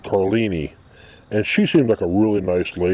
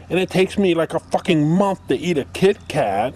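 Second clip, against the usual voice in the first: high, prissy mocking voice; sneering high-pitched parody of a petite co-host; mocking Chrissy Lee; 9 semitones higher than usual. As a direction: mimic her cutesy little-girl whine. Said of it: "she sits there and talks about".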